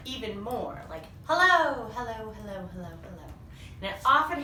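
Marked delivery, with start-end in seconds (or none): 1.3-1.9 loud drawn-out hello; calling out. Shown as a demonstration. Teacher showing kids what an echo is, calling out. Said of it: "my voice is probably echoing". 1.9-3.2 fading echo. Shown as a demonstration. Teacher showing kids how an echo sounds, fading away. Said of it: "what it means to echo".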